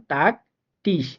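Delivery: টাক and টিস said with a crisp Bangla ট at the start; German Tag and Tisch with a clear T. Shown as a demonstration. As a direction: say টাক, টিস with a hard ট sound. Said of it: "ট এর মতো উচ্চারিত হবে".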